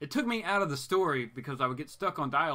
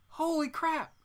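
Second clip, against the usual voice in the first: very high-pitched disbelief; squeaky, astonished outburst; amazed exclamation; 14 semitones higher, slower than usual. Real, not acted. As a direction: let the voice shoot up in astonishment. Amazed at the drug routine.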